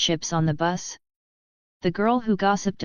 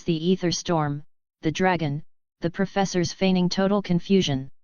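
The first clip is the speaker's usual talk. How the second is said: ordinary delivery, nothing marked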